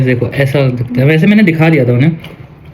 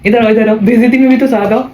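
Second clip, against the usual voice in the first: high-pitched, teasing summons; playful calling over; 8 semitones higher, faster than usual. Real, not acted. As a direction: call her over in mock grievance, voice pitched way up. (ऊँची आवाज़ में मज़ाकिया शिकायत से बुलाना)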